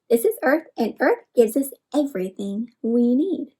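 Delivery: ordinary talk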